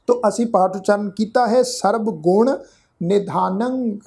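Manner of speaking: ordinary talk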